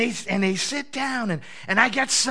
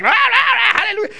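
frenzied praise; 12 semitones higher, 10 dB louder than usual. Retold or imitated. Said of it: "everybody's like".

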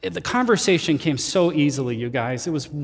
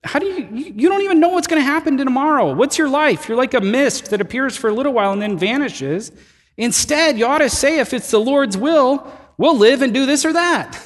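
emphatic rebuke; 8 semitones higher than usual. Retold or imitated. Pastor voicing James's rebuke with raised fervor. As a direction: press the point with rising fervor. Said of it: "His response".

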